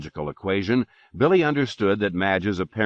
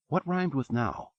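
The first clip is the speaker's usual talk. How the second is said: high soft wondering; high puzzled murmur; 8 semitones higher, 5 dB quieter than usual. A narrator's reading. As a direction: ask yourself it softly, up high. Billy thinking aloud.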